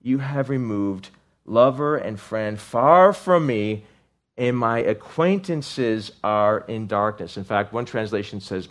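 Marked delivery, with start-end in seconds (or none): 0.0-7.4 slow lament. Acted.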